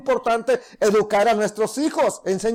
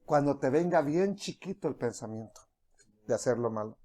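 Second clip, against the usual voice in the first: voz baja; 10 semitones lower, 10 dB quieter than usual. Acted. El pensamiento pequeño del pecado.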